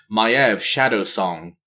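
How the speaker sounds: usual speaking voice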